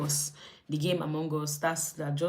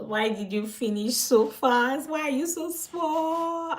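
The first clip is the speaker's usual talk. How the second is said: high, slow, drawn-out wail; mock lament; 9 semitones higher, slower than usual. Cook pleading with the shrinking rice pot, mock lament. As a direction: wail it in hammed-up despair.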